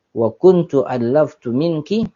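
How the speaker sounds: usual speaking voice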